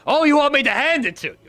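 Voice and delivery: mocking laugh. Villain mocks coworker's demand.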